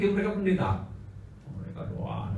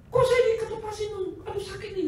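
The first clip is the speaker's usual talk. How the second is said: put-on complaining boy.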